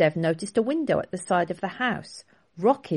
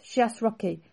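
ordinary delivery

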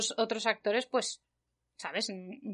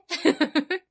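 high laugh; 6 semitones higher, 5 dB louder than usual. Real, not acted. Laughs at her own reaction.

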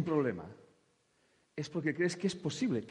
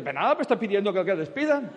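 laughing god reply; 7 semitones higher, 10 dB louder, faster than usual. Retold or imitated.

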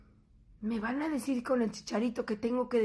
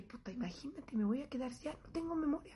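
softer whispered panic, near tears; panicked whisper; 9 dB quieter than usual. Retold or imitated. Her fearful thought about memory loss, whispered. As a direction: whisper it like you're losing your mind.

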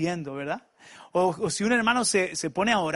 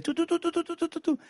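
high rapid tu-tu-tu mocking foreign chatter; mock English gabble; 10 semitones higher than usual. Mocking the English speaker's stream of words, high and rapid. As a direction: gabble tu tu tu to mimic his English. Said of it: "empezó a hablarme en inglés".

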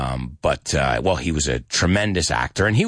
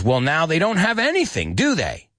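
high-pitched sarcastic frustration; acted exasperated remark; starting frustration; 10 semitones higher than usual. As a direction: needle them, pitch lifted. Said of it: "He's starting to get frustrated".

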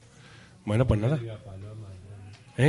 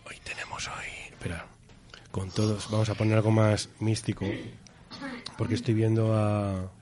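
hushed announcer; 4 semitones lower, 9 dB quieter, slower than usual. Acted. Host's hushed mystical show opener.

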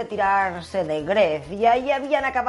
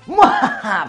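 short laugh; 2 semitones higher, 8 dB louder than usual. Real, not acted. Laughs about secret operation.

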